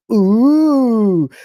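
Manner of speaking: loud, high, drawn-out ooh; exaggerated fake-awed coo; mock grifter ooh. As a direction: mockingly gush a long ooh. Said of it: "this is grifting one oh one".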